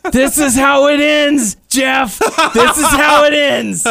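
high, loud, slowed shouted promo laughing through it; dramatic delivery. Retold or imitated. Showing how Noah would deliver the line, high and loud. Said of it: "That kind of delivery".

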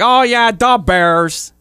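a hammed-up Chicago parody accent, drawn out. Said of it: "someone said Chicago".

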